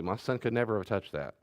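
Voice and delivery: usual speaking voice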